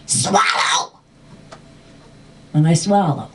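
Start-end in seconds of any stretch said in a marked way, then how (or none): 0.1-1.0 voiceless, forced-out order; urgent command. Retold or imitated. Dragon coaching child through turnip, harsh urgent command. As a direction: push it out as one rasped burst.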